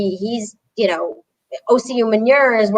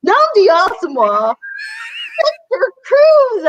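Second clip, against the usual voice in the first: hammed-up overexcited scream, much higher and louder, the name drawn out; imitating a screaming kid; 3 dB louder than usual. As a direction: mimic the kid's crying yell of the name, stretched and cracking. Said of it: "and he's like".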